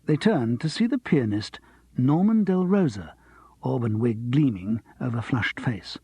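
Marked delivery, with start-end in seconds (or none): none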